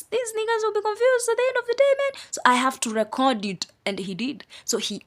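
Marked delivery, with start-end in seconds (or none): 0.0-2.2 high, quick, exasperated imitation; imagined inner voice, pitched way up and hurried; God. Preacher imagining the Creator foreseeing human confusion, high quick mock voice. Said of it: "It's like God knew".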